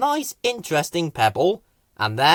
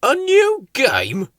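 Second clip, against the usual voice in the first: surprised question; 10 semitones higher, 5 dB louder, slower than usual. Acted. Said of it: "said Slow Coach".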